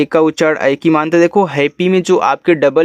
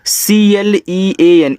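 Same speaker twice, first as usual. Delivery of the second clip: slow, higher letter-by-letter spelling; 4 semitones higher, 3 dB louder, much slower than usual. Shown as a demonstration. Teacher starting a new word for learners, slow spelling out. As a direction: call out each letter slowly.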